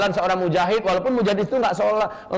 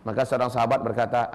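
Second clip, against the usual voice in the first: usual speaking voice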